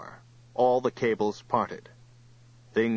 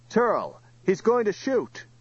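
high, urgent shout; shouted warning; 10 semitones higher, 3 dB louder than usual. A narrator's reading. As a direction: shout a desperate warning across a distance. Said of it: "he shouted".

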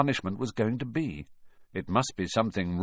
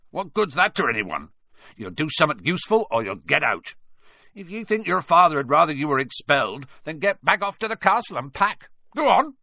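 rising louder, higher, fast; angry scolding; 7 semitones higher, 5 dB louder, faster than usual. Acted. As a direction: build to a furious order. Hagrid scolds Malfoy.

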